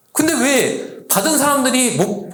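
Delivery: ordinary talk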